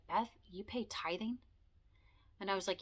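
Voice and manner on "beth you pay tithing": whispered, half disbelieving question; incredulous whisper. Retold or imitated. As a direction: lightly mimic a friend's shocked hush. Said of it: "She was like".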